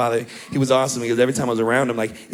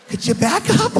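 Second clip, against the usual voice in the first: uneasy plea; 3 dB louder than usual. Retold or imitated. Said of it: "you're like".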